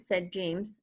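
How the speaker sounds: usual speaking voice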